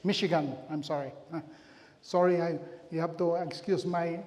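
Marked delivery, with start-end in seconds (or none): none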